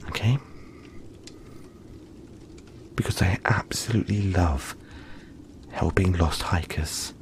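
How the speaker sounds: hushed, tender whisper to a lost hiker; soothing whisper. Acted. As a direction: breathe the words like a lullaby. Soft bedtime caretaking whisper.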